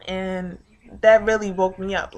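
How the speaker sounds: usual speaking voice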